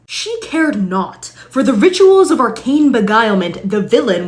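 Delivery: grandiose archaic rant building to loud; pompous old-timey adventurer. Acted. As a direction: lecture like a self-important knight of old.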